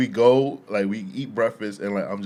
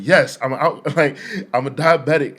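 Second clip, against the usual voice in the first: loud insistence; 6 semitones higher, 9 dB louder than usual. Retold or imitated. Alvin insisting to the lady.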